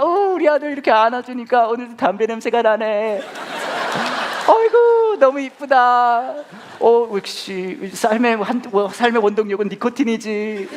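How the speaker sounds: high, loud, slow cooing with a laugh; hammed-up doting father. Father praising son's smoking, exaggerated adoring coo. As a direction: praise the bad habit with mock delight.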